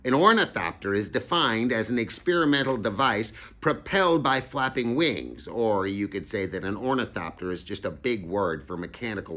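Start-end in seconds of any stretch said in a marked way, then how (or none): 0.0-5.5 put-on know-it-all kid. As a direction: recite it like a little professor. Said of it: "and he goes".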